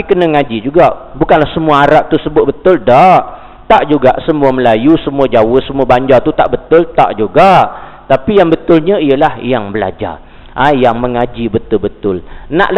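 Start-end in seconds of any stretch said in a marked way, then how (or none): none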